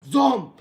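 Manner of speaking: loud high whoosh sound; shouted whoosh. Acted. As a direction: blast the zomp. Sound effect shouted at rival.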